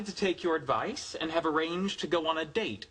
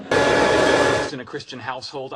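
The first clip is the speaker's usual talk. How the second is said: audience laughing loudly; crowd laughter; 11 dB louder, slower than usual. Real, not acted. Laughter over line.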